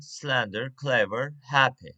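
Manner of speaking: "slender", "clever", "happy" each strong at the start; "slender, clever, happy" as a list with front stress; stress on first syllable. Shown as a demonstration. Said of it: "stress on first syllable".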